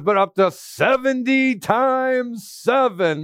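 a preacher reading scripture aloud. Acted. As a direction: read the verses out with weight.

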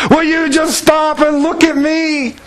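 high, slow, drawn-out plea; hammed-up fed-up wife, every word stretched; exasperated demand. Wife grabbing husband by the ears, acted exasperation. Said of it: "grab your husbands by the ears and go".

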